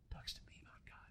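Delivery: barely audible whispered aside; hushed whisper. Real, not acted. Preacher on his cousin who talks to him about God, whispered. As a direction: drop right down to a whisper.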